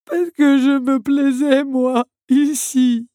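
high, loud, slow mournful voice; tearful automaton. A narrator's reading. Automaton answering Belle, heartbroken. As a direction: draw it out, grieving.

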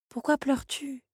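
high, soft, tender; gentle question. A narrator's reading. Belle gently asking the crying automaton. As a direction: ask softly, comforting. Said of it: "l'interrogea Belle en lui caressant".

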